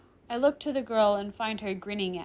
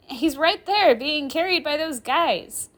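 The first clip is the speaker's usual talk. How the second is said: higher, louder character voice, lively; Rimuru's girl voice; 8 semitones higher, 10 dB louder than usual. Acted. Girl pointing someone out to the professor, high and loud. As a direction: pitch it up for the girl, projecting.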